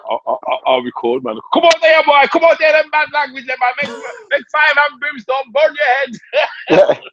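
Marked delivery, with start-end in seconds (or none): 1.5-7.0 mock preacher. Comedian mocks preaching against cuss words on the show. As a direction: ham up a hellfire sermon.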